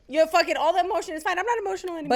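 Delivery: high, quick, airy reassurance; mock all-better voice. Friend mimicking mood swing passing, high-pitched mock relief. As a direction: chirp it high and fast.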